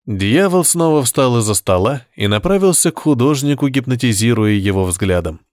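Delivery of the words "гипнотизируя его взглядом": ordinary delivery, nothing marked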